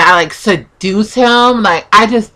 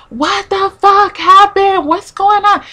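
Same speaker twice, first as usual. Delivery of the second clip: high-pitched, drawn-out outburst of disbelief; freaking out; 11 semitones higher, slower than usual. Retold or imitated. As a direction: imitate a man suddenly losing it, voice shooting up. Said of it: "So he starts freaking out".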